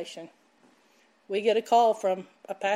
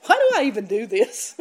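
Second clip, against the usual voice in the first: laughing self-doubt; 5 dB louder than usual. Retold or imitated. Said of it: "you know what I was telling myself".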